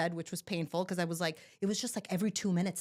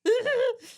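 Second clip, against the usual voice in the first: high, loud pushing yell; belted push yell; 7 dB louder than usual. Retold or imitated. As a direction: bellow like pushing the baby out. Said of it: "And I belted".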